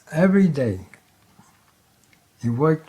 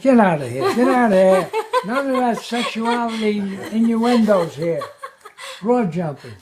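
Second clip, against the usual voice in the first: loud, raised comic telling-off; scandalized official, played for laughs; mock indignation; 7 semitones higher, 4 dB louder than usual. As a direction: throw him out in mock outrage. Acted official throwing him out.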